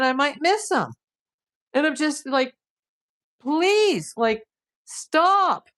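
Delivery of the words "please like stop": high, drawn-out plea; exasperated plea. Retold or imitated. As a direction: beg them to quit, pitched well up. Acts out her exasperated plea.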